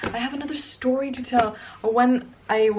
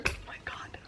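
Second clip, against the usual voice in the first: whispered, heartbroken aside; hushed lament; whispered dismay; 14 dB quieter, faster than usual. Real, not acted. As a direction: breathe oh my god in a whisper. Sad about her poor car.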